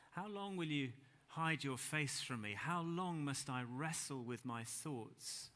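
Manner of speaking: drawn-out, slow lament; slow plea read out slowly to the church. Shown as a demonstration. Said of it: "I'll read it slowly".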